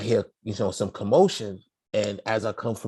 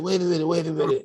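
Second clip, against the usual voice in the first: panicked pleading; 6 semitones higher, faster than usual. Retold or imitated. Pleading with the cop.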